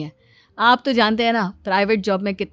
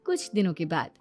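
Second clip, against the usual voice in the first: calm, quieter storyteller voice bridging the scenes; story narration; 5 dB quieter than usual. A narrator's reading. Narrator telling listeners about Sumitra's visiting friends, calm storytelling. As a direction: narrate the time jump evenly.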